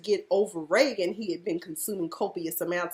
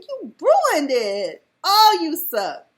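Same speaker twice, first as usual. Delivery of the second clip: slow, loud, higher scold; disgusted scolding; 7 semitones higher, 9 dB louder, much slower than usual. Real, not acted. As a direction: drag out the disgust. Scolding Doug slowly.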